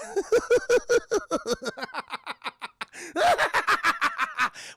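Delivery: high maniacal cackle; imitated Joker cackle. Jokers laughing in the sewer tunnels, high cackle. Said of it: "we hear the laugh of not the Joker".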